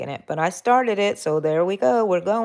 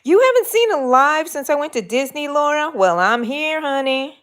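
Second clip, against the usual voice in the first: higher, louder cheerful hello to fans; excited greeting; 9 semitones higher, 7 dB louder than usual. Real, not acted. Host greets returning viewer Laura, bright excited welcome.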